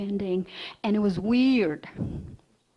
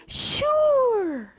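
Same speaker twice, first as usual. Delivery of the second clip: high, drawn-out confident answer falling away; utterly sure; 11 semitones higher than usual. Retold or imitated. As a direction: sing out total certainty on one long word. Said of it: "never anybody was so sure before".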